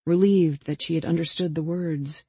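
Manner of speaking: plain reading voice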